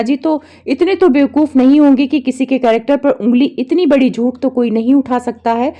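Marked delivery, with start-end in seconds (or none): none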